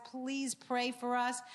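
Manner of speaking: ordinary talk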